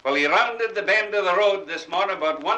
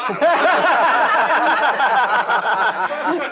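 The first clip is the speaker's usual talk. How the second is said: loud laugh; 6 semitones higher, 6 dB louder than usual. Real, not acted. Laughs at own joke.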